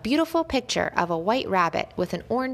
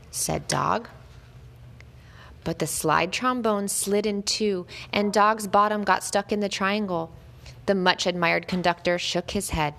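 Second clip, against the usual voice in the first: plain reading voice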